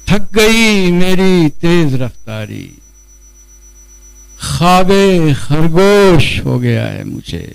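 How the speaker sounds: measured mushaira recital with drawn-out rhyme words; Urdu poetry recitation. Acted. Poet reciting his ghazal to a gathering, declamatory recitation. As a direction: declaim each line, let it fall away at the end.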